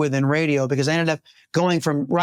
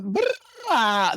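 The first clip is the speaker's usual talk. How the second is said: jock growl; 5 semitones higher than usual. Retold or imitated. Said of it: "do that".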